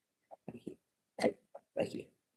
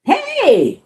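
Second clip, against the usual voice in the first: high, loud call; 10 dB louder than usual. Acted. Bus driver calling out to the pigeon. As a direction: shout hey across.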